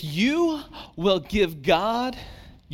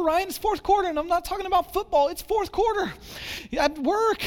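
much higher, fast, frantic complaint; frazzled busy person; 7 semitones higher than usual. Retold or imitated. Busy churchgoer pleading with the pastor, frantic and higher. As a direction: rattle it off like an overworked parent pleading. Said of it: "where you say".

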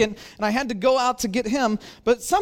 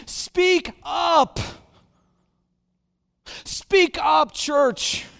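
rally the church; exhorting shout; preaching shout; 6 semitones higher, much slower than usual. Real, not acted. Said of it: "preacher exhorts church".

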